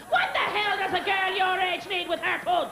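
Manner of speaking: a hint of an exasperated dad; baffled dad. Baffled dad voiced in story.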